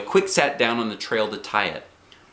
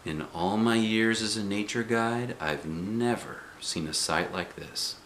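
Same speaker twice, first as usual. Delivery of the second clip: softer, steady and gentle; quiet calm instructions; 7 dB quieter than usual. A narrator's reading. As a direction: keep the child still with calm words. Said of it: "real quiet and calm".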